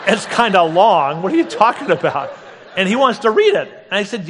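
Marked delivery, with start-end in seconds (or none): 0.1-2.3 mock exasperation broken by laughter; laughing disbelief. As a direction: laugh through the retold scolding. Glaring at friend, exasperated retelling.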